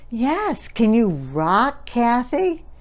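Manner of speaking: slow, sing-songy mother asking; mommy voice. Shown as a demonstration. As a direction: coax the little girl gently along. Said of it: "And Mama says".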